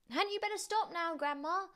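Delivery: put-on high-pitched, anxious boy's question; worried boy. A narrator's reading. George watches the ceiling break, worried boy's question. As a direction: plead high, like a small boy.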